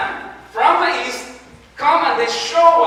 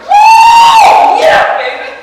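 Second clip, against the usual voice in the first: whooping at full shout, much higher; wild cheering; 14 dB louder, slower than usual. Pretend. Preacher imagining hearing of the new king, whooping shout. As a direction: scream it out like the best news ever. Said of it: "I would be like".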